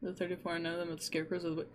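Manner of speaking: ordinary delivery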